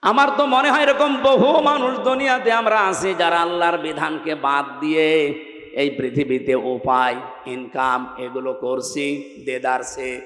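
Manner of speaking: ordinary talk, nothing marked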